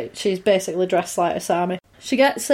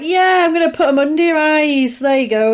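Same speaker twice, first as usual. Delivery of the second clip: higher, lively put-on voice; imitating torturer; 5 semitones higher, 4 dB louder than usual. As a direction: mimic her, pitched up as she works. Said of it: "And she's like".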